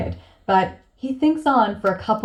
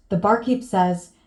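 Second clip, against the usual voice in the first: normal talking voice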